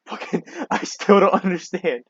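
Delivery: slow, incredulous laugh through it; laughing disbelief. Real, not acted. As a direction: laugh at the absurdity.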